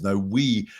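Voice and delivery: ordinary talk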